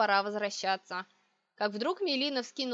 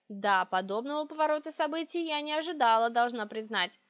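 ordinary delivery

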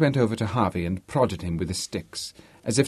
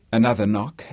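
plain reading voice

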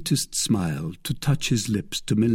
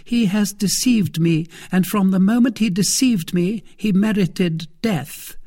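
high, louder, grave accusation; serious; 9 semitones higher, 3 dB louder than usual. A narrator's reading. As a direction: pronounce a verdict in a high woman's voice. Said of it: "said Milady seriously".

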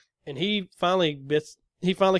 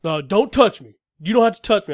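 louder, higher insistence; mock offended yell; 4 semitones higher, 7 dB louder than usual. Driver refusing contact, louder mock yell. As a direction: ham up his outrage.

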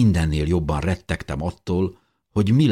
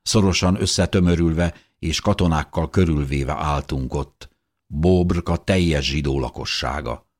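ordinary delivery

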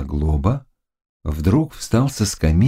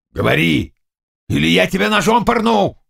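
rough, raised-voice threat; threatening roar; 10 semitones higher than usual. Acted. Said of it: "рявкнул Заглоба". (грозный рявкающий окрик)

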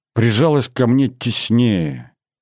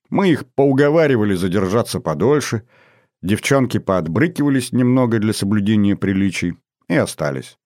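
plain reading voice

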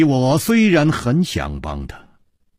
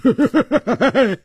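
high, falling chuckle; amused laugh; 11 semitones higher than usual. A narrator's reading. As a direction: laugh it off teasingly. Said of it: "笑着说".

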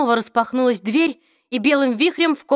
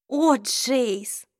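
sorrowful; 3 semitones higher than usual. Acted. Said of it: "печально произнесла Изабель".

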